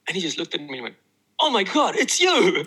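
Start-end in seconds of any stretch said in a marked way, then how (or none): 1.4-2.6 high, loud, laughing gasp of recognition; stunned recognition. Retold or imitated. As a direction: act out someone suddenly recognizing a face. Customs officer recognizes him.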